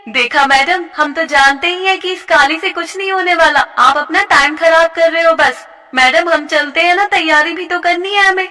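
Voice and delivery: mocking schoolgirl. A mean girl mocking a classmate to the teacher, scornful. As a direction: sneer at the teacher's choice.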